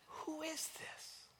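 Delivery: high, awed questioning, swelling then hushed; wonder and amazement. Acted. Preacher asking who this Jesus is, in wonder. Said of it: "out of wonder and amazement".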